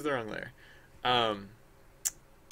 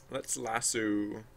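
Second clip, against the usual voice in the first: let's lasso stretched slow, every sound drawn out; 3 dB quieter, much slower than usual. Real, not acted. Streamer narrating her own tool choice, slowly drawn out. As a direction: savour the word lasso slowly. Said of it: "repeats tool name while working".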